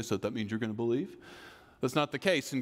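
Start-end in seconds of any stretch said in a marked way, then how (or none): 0.0-1.2 sarcastic. Retold or imitated. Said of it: "you look at them sarcastically and you say".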